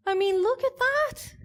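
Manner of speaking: very high-pitched cooing; gushing over cuteness. Real, not acted. Speaker showing the audience cute animals, high cooing delight.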